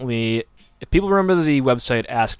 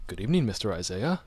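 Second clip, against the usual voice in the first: soft, proper butler greeting; lightly voiced formal butler, softer than usual; 8 dB quieter, faster than usual. Shown as a demonstration. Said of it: "let's hear your butler voice".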